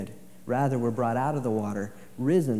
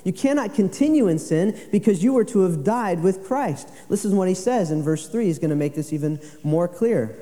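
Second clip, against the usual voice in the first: raised, louder preaching voice; forceful preaching; 7 semitones higher, 5 dB louder than usual. Acted. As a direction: preach it out to the congregation.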